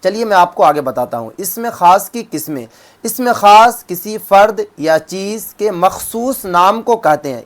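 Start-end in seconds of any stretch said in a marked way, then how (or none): 3.0-7.4 slow dictation. Shown as a demonstration. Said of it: "اسم خاص کسے کہتے ہیں؟".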